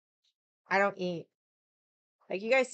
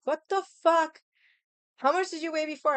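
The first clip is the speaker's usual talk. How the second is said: mock shocked friend, much higher and louder; 8 semitones higher, 5 dB louder than usual. Friend seeing the scale read one oh two, much higher mock shock. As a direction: squeal the friend's disbelief.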